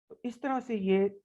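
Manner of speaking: normal talking voice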